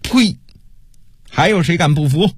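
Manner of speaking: high contemptuous spit, then a menacing challenge; vicious threat. A narrator's reading. Prince intimidating cowed officials, vicious threat. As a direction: dare anyone to defy you, viciously. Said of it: "恶狠狠地对着众人说道".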